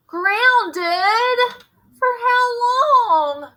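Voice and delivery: high, loud and slow, disbelieving; shocked protest. Acted. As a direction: wail it out in outraged surprise. Boy hears he is grounded.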